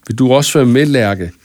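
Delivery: usual speaking voice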